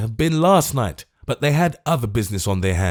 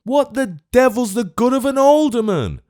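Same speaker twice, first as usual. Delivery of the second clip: high, loud scornful demand; choleric outburst; 14 semitones higher, 5 dB louder than usual. Acted. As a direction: spit out the scorn. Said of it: "the choleric old gentleman".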